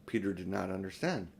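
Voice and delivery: usual speaking voice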